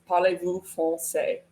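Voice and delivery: schoolbook "Parlez-vous français"; learner's French question; childhood-learned French. Shown as a demonstration. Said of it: "when I was learning it growing up".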